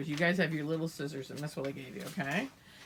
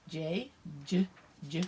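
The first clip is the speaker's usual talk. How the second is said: ordinary talk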